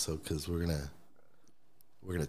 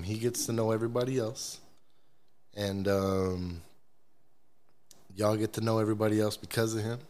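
usual speaking voice

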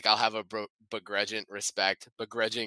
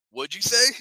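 mock barked question; 10 semitones higher, 7 dB louder than usual. Acting the hero's reply to sergeant.

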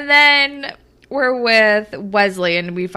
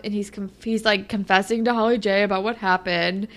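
ordinary talk, nothing marked

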